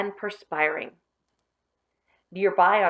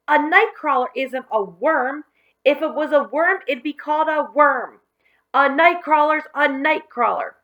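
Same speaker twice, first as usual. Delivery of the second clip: higher, louder indignant protest; Billy kicking barrels, refusing the worm; angry arguing; 7 semitones higher, 6 dB louder than usual. Acted. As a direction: storm and argue it. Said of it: "stormed about, arguing".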